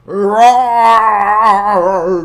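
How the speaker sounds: loud drawn-out bear growl; loud bear roar. Acted. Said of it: "growled Old Bear".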